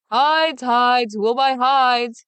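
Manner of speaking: loud, high hawker's shout, drawn out slowly; Big Claus keeps crying his wares; shouting street cry. Acted. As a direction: keep hollering to the whole street. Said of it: "he kept on shouting".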